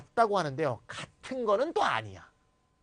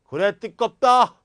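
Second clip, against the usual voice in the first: loud, hammed-up grumpy refusal; mock annoyed refusal; 4 semitones higher, 12 dB louder, slower than usual. Refusing to let someone open the window, mock angry and loud. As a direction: snap it out like a grumpy neighbor. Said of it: "이제 yes, I do".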